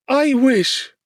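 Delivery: high, loud anguished cry. A narrator's reading. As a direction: cry out in despair. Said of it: "cried she".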